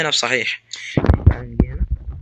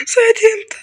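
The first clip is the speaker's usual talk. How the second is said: squeaky mock voice; 5 dB louder than usual. Squeaky high mock question.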